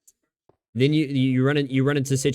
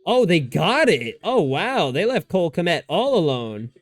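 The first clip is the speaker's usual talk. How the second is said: higher, amazed play reaction; real excited reaction; 4 semitones higher, slower than usual. Real, not acted. Fan watching a fourth-down conversion, excited.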